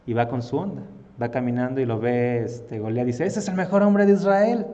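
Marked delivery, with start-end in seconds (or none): 3.2-4.7 high, loud scornful taunt; burla incrédula. Acted. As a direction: jeer loudly at a puny opponent. Acted Goliath mocking David. (burla fuerte y despectiva)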